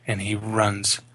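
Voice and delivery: ordinary talk, nothing marked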